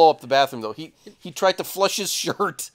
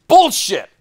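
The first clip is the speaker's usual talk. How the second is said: angry outburst; 5 semitones higher, 7 dB louder than usual. Retold or imitated. Throwing cards at dealer.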